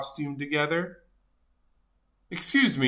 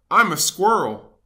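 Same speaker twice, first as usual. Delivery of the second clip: ordinary delivery, nothing marked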